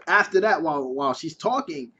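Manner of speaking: ordinary talk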